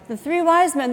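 ordinary talk

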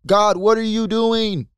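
pleading complaint. Retold or imitated. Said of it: "a lot of us will be like".

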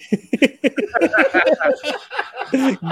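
high cackling laugh at the screen. Real, not acted. Laughs at a wrestling moment.

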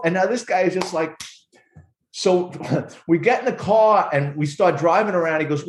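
ordinary talk, nothing marked

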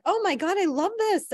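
high, gushing imitation. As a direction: gush over the dress. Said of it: "She was like".

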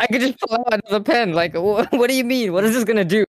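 high-pitched, mock-indignant student; incredulous back-talk, acted out; retold incredulous protest. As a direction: protest in disbelief, pitched well up. Student argues back at teacher.

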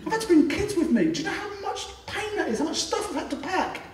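high, pleading, laughing complaint; high-pitched whiny parent begging to be let in, with laughter. Retold or imitated. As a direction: plead like an exhausted parent turned away at the door.

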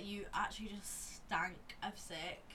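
slow unvoiced whisper; whispering a teasing jab to her friend; whispered tease. Real, not acted. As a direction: confide it slowly like a secret. Teasing about smelling of sick.

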